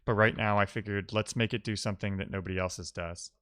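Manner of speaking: ordinary talk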